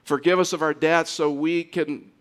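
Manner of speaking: usual speaking voice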